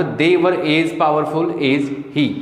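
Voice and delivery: very slow, word by word; slow classroom dictation. Shown as a demonstration. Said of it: "teacher repeats slowly".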